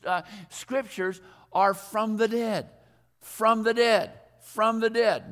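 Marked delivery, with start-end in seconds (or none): 1.7-5.3 FROM THE DEAD repeated louder, each a separate blow; "from the dead" punched, with pauses between; emphatic refrain. Shown as a demonstration. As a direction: hammer "from the dead" as the key theme. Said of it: "The common theme of all".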